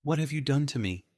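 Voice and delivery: high, shaken plea; a frightened man begging; broken and afraid. A narrator's reading. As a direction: gunman pleading in fear. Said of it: "voice broken and afraid".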